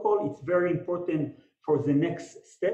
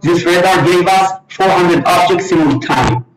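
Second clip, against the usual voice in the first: overloaded mic, too close; loud, clipping mic sound; distorted mic; 13 dB louder than usual. Real, not acted. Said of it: "can't really understand the mic".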